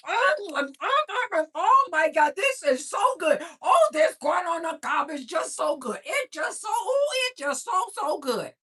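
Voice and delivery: high, loud, gushing over the corn; overjoyed moans of food bliss, hammed up; loud rapturous eating. Bob loudly savoring corn.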